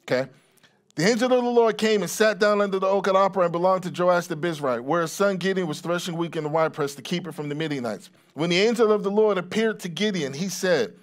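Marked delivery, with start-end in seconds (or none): 0.9-9.9 fast recap reading of scripture; quick re-read. Real, not acted. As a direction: read briskly in one run. Repeats verse after aside, faster.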